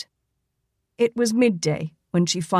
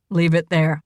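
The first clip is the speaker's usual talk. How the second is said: low gruff command from Cole; gruff order; 3 semitones lower, 4 dB louder than usual. A narrator's reading. Wounded man gruffly ordering the unseen visitor to leave it. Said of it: "he ordered gruffly".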